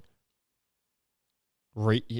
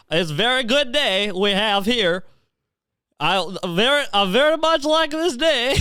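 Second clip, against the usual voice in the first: higher-pitched, drawn-out mock Kazakh Borat accent; 8 semitones higher than usual. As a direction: give the wedding speech in a goofy Borat accent. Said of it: "stop doing a Borat voice".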